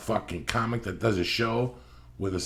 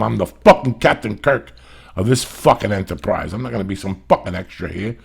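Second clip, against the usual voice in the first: cocky declaration; 8 dB louder than usual. Retold or imitated. Scripting bold line for agent.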